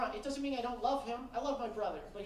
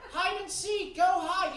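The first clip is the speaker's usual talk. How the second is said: dad brushing off; 6 semitones higher, 5 dB louder than usual. Retold or imitated. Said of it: "He's like".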